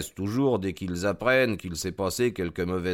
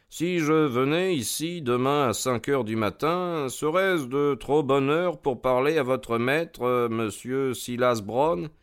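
polite detective's enquiry, lightly raised in pitch; lightly voiced Holmes; 5 semitones higher than usual. Holmes asks the groom about his master, lightly voiced and courteous. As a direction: lightly play a courteous detective asking a favour.

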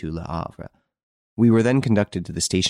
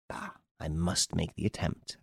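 said to himself; 9 dB quieter than usual. A narrator's reading. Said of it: "I said to myself".

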